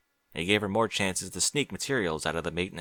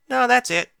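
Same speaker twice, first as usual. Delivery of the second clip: put-on young girl voice; 9 dB louder than usual. Applebloom voiced high.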